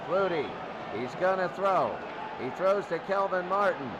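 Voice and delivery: normal talking voice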